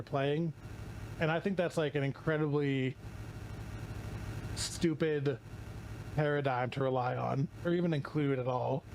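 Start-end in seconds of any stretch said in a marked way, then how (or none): none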